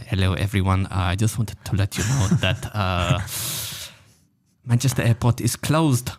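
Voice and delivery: put-on halting airline captain announcement in a muddled German-South African accent; loosely imitated German-ish, South African-ish accent; captain's shaky-landing accent attempt. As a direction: attempt a foreign-accented pilot's announcement, pausing before the bad news. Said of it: "I don't wanna do the accent".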